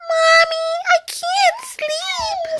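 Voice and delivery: put-on very high, drawn-out child voice; little Bunny. A narrator's reading. Youngest child calls to mommy, very high slow whine. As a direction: squeak it slowly like a sleepless little rabbit. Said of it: "said her youngest child, little Bunny".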